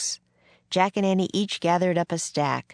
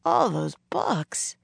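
slow, sorrowful trailing off; 3 semitones higher, slower than usual. A narrator's reading. Jack mourns the lost books, voice trailing off. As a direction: draw it out slowly and let it fade. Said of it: "his voice trailed off".

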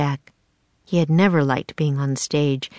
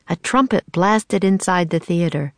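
ordinary delivery

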